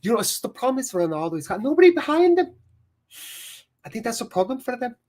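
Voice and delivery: high, fast Scouse pundit impression; Jamie Carragher. Streamer mimics a pundit's Ronaldo gripe, squeaky Scouse impression. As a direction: rattle it off high, like an excitable Liverpool pundit. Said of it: "that was Jamie Carragher".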